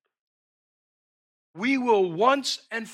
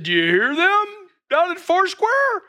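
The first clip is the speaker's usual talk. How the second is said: higher and louder, a teasing nudge; playful God to angels; 12 semitones higher, 8 dB louder than usual. Acted. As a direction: ask it like a grinning elbow in the ribs.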